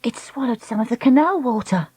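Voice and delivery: alarmed cry. Acted. Dakota fearing for the turtle, alarmed. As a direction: report it with alarm. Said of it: "Dakota cried".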